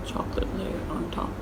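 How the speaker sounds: soft breathy whisper. Real, not acted. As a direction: whisper it to yourself. Quiet aside while digging in.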